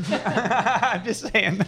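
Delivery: slow, laughing aside. Real, not acted. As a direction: chuckle through it. Laughing off the heat.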